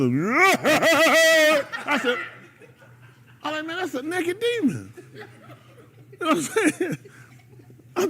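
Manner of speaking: high, laughing through the words. Real, not acted. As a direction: crack up while telling it. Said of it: "laughing while telling about the demon".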